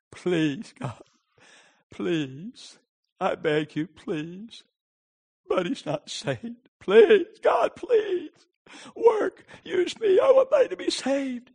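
pleading that builds from hushed to high cries; begging prayer. Retold or imitated. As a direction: start small and climb into desperate begging. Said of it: "I just begged".

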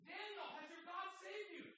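high call down into the den; king calling out. Retold or imitated. King Darius calling down to Daniel in the den. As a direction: call anxiously into the pit.

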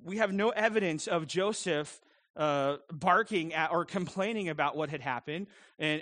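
normal talking voice

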